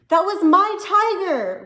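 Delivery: put-on high, loud furious boy's scream. A narrator's reading. A boy screaming at his father over the dead tiger. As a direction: scream it at him, heartbroken. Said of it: "Rob screamed".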